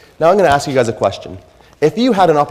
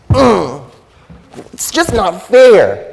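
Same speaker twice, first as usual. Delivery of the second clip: higher, louder, very slow sulky whine; whining; 8 semitones higher, 8 dB louder, much slower than usual. Retold or imitated. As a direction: drag out a pouting ugh, it's not fair. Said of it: "doing a bit of whining".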